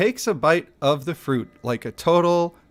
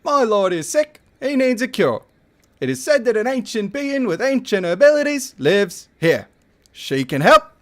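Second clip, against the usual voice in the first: commanding soldier voice; 9 semitones higher, 4 dB louder than usual. Acted. Soldier again.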